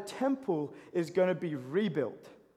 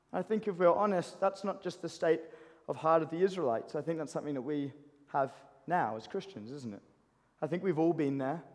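normal talking voice

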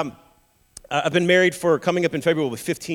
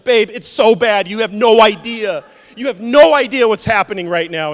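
feed her the scary story; mock dire; 4 semitones higher, 11 dB louder than usual. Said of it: "I'm like".

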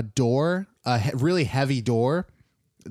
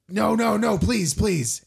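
pleading; 9 semitones higher, slower than usual. Acted. Captive begs for mercy.

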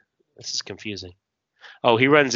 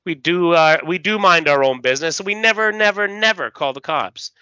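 higher, forceful declaration; emphatic acted insistence; 7 semitones higher, 6 dB louder, faster than usual. Bartender lectures a patron on outlaw code, forceful insistence. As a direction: drive home the rule.

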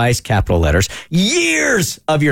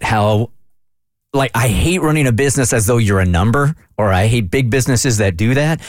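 ordinary talk

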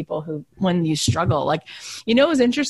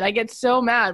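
normal talking voice

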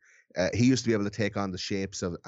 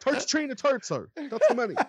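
much higher, playful Irish brogue; milk the Irish accent for laughs; hammed-up Irish accent; 4 dB louder than usual. Irish guest repeating his catchphrase to co-hosts, hammed-up high Irish brogue. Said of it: "it's my Irish accent there".

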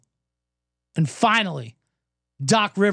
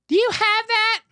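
put-on high, ditzy drunk girl voice; mock a tipsy girl at the bar. Mocking drunk girls at a dive bar, high ditzy voice. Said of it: "they're like".

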